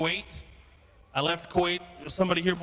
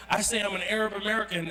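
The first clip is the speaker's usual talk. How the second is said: ordinary delivery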